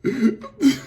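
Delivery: high, helpless laughing fit; real laugh. Real, not acted. Reactor reminded of a Chris Rock joke, laughing. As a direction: keep cracking up at the memory. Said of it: "I'm laughing because".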